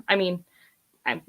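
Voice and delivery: ordinary delivery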